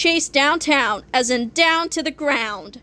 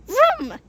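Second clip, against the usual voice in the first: high-pitched vroom; quick, high motor noise; engine vroom; 10 semitones higher than usual. A narrator's reading. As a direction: imitate a revving engine. Imitated engine noise in story.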